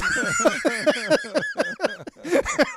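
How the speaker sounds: high, helpless laugh at the absurdity; a sudden high cackle of disbelief; burst of laughter. Real, not acted. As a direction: crack up completely. Laughing at cop's grand theft line.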